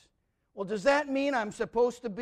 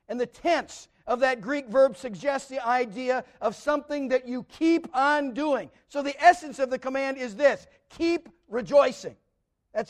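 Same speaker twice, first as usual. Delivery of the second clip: preaching at full force; 5 semitones higher, 7 dB louder than usual. Acted. Preacher explaining Greek words to the congregation, preaching hard. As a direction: drive the point home to the congregation.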